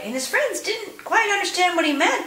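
ordinary delivery

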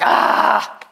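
loud, mock-frustrated "ah"; imitated cry of bored confusion; exasperated groan. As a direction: blurt out a hammed-up sigh of overwhelm. Imitates frustrated, confused Bible reader.

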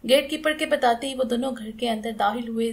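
plain reading voice

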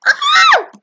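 sudden high scream of fright; startled scream. Real, not acted. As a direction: shriek in real panic. Monster appears at night.

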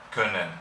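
können with a short Ö before the double n; German können. Shown as a demonstration. Teacher pronouncing the German verb können. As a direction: clip the Ö in können. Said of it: "el verbo poder".

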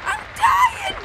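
very high, loud screams; panicked screaming. Real, not acted. Gamer dying on the fake beach, panicked shrieks. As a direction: shriek like you are dying.

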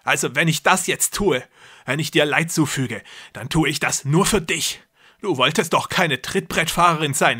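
loud and grandiose, playing a god; deranged brother. A narrator's reading. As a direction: brother lecturing his trapped sister. Jakob threatening sister.

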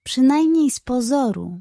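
plain reading voice

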